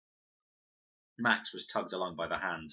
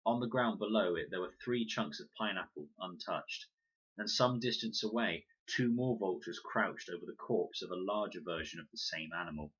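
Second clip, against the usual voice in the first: ordinary delivery, nothing marked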